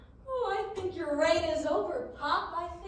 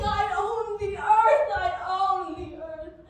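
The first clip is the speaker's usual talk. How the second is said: slow triumphant gloat; 3 semitones higher, 8 dB louder, slower than usual. Acted. Gloating about owning earth.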